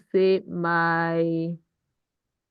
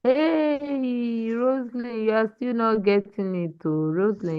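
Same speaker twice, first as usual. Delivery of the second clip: exasperated; 5 semitones higher than usual. Real, not acted. Teacher to Rosalind still not getting it, exasperated. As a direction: draw out 'hey' high, then drop away. Teacher reacting to repeated mistake.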